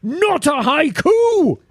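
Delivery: high, drawn-out indignant cry; hammed-up outrage, slow and high-pitched; mock angry. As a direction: read it out like an angry scrawled note. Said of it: "it's angry writing as well".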